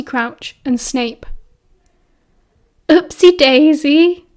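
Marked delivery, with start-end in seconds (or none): 2.9-4.2 slow, high, loud mocking sing-out; cackling taunt. A narrator's reading. Crouch to Remus after he fell down the stairs, cackling taunt. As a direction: draw it out high and gleeful to taunt. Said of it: "Crouch cackled".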